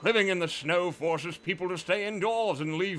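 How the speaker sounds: hammed-up British accent, haughty grump. The tax collector scoffing at peasants in a mock British accent. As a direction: sneer it like a snooty British miser. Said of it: "I apologize to all British people".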